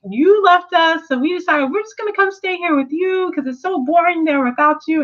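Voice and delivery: Diamonds telling Steven they came to stay, high and put-on; diamonds' voices. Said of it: "They're like".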